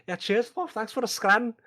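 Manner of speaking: put-on high, loud charming British accent. Player in character thanking the hostess, British accent. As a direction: lay the British charm on thick. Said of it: "I will try to lay on the charm".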